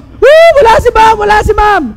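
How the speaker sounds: high, loud whooping shout of glee; rowdy cheering. Retold or imitated. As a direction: yell like boys who just lost their teacher. Rowdy boys when teacher leaves. (sigaw ng magugulong lalaki)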